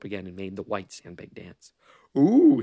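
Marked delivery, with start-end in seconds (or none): 2.2-2.6 high, loud rising "ooh"; loud taunting crooned "ooh"; chanted ooh. A narrator's reading. Azar taunting as he dances the sandbag, chanting. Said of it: "he was chanting".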